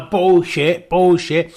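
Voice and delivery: loud, stubborn comic dictator; broken English Kim. Retold or imitated. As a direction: bark it like a spoilt tyrant. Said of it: "he went".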